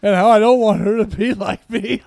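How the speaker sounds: laughing. Real, not acted. Laughs while telling it.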